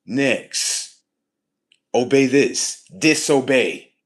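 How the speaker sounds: shouted, high-pitched orders; acted commands. Defying the Matrix order by order, shouted commanding. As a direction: belt out each command on its own.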